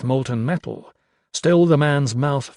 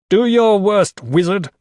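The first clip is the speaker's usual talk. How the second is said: loud, raised yell through gritted teeth; defiant yell; 7 semitones higher, 7 dB louder than usual. A narrator's reading. Crow answers the wizard's threat, yelling back. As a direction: hurl the dare back at him. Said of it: "Crow yelled back through gritted teeth".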